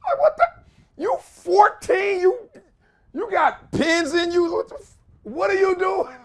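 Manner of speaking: high, stunned what-are-you-doing; incredulous disbelief. Real, not acted. Incredulous at kids icing.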